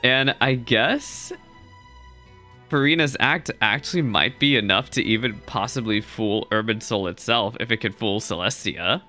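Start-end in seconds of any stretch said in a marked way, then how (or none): none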